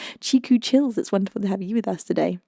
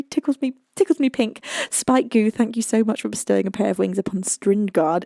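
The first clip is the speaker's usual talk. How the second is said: ordinary talk, nothing marked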